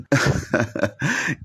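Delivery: soft breathy giggle; a bashful little laugh; shy giggle. Acted. Wife laughs at her request.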